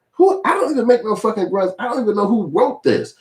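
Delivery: high-pitched, acted protest; indignant denial. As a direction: play her outraged at the accusation. Acts her indignant denial to coworkers.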